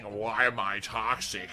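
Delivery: slow quote of a taunt; mocking quote. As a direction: drag it out, mildly mocking. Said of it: "we're just gonna keep saying".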